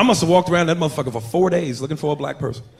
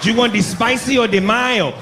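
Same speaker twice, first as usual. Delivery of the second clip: mock Mexican accent; 6 semitones higher than usual. Cashier at Popeye's repeating the order in a light Mexican accent. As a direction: read the order back in a mild Mexican accent. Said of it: "He tried to repeat the shit back to me".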